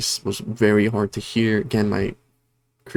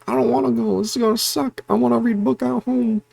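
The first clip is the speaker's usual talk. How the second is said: put-on high, whiny reluctant kid; 13 semitones higher than usual. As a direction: whine like a kid dragged out of the house. Voicing the reluctant book girl.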